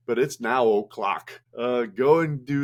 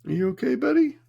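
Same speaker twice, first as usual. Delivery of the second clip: high-pitched coaxing pet voice; doggy talk; 11 semitones higher than usual. Real, not acted. Owner coaxing the dog to come up here, high-pitched doggy talk.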